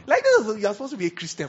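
loud, high burst of disbelief; incredulous at a pastor's gossip; exasperated outburst. Real, not acted.

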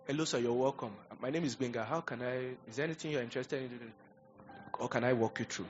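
lightly voiced salesman, soft and courteous; soft-spoken sales greeting, acted out; polite salesman. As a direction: play a courteous shop assistant, slightly hushed. Acting out salesman greeting a customer.

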